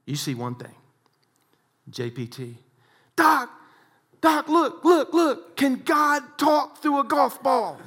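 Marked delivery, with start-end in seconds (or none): 3.2-7.8 much higher and louder, last line slowed; excited call. Retold or imitated. Golfer amazed by his ball, calling Doc, loud and high. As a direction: shout in amazement to your friend. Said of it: "golfer calls out to Doc".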